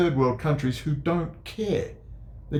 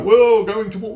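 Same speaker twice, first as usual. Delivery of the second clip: higher, louder mock alarm; mocking McGregor; 8 semitones higher, 7 dB louder than usual. Mocking Colonel McGregor's war talk, loud and high. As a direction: ham up a startled pundit. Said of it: "And there's McGregor".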